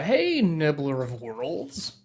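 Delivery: drawn-out grand welcome; slow dramatic greeting. Real, not acted. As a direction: stretch out the name grandly. Host greets new caller dramatically.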